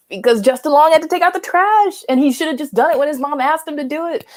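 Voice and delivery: high-pitched, hammed-up indignation; mock exasperation. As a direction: raise the pitch in comic disbelief. Mock outrage at absurd stakes.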